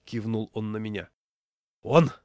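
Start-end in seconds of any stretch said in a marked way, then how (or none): 1.8-2.1 high, loud one-word accusation; exhaled accusation. A narrator's reading. Raincoat man identifying the culprit, breathed out emphatically. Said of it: "выдохнул рыжий плащ".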